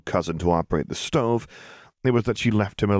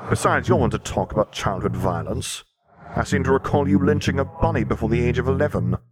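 grim reaper voice; 6 semitones higher than usual. Acted. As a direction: speak as Death himself. Death speaks.

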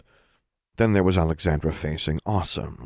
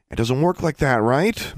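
higher-pitched exasperation; annoyed; 9 semitones higher, 4 dB louder than usual. Acted. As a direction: scold with mild annoyance. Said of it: "sounding more annoyed than angry".

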